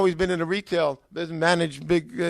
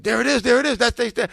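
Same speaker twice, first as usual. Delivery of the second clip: excited spotting; 6 semitones higher, 4 dB louder than usual. Retold or imitated. Wife spots the awaited envelope.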